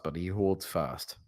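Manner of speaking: ordinary talk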